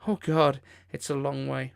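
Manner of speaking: ordinary talk, nothing marked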